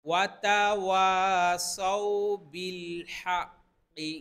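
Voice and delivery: intoned, drawn-out tajweed recitation; Quran recitation. Acted. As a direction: recite slowly in a chanting tone.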